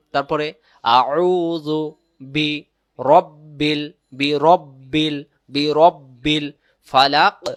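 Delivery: বি রব্বিল ফালাক drawn out slowly and loudly; slow careful recitation. Shown as a demonstration. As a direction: teach each syllable slowly. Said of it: "কুল".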